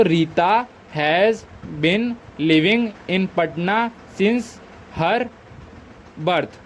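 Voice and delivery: very slow, word by word with pauses; patient dictation. Shown as a demonstration. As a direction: lay out each word for the students. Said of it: "teacher dictating finished sentence".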